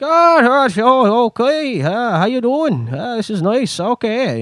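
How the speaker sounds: a friendly foreign official in a put-on Canadian-Irish lilt; Canadian-Irish accent. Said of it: "I don't even know what accent that is. It's, like, Canadian slash Irish".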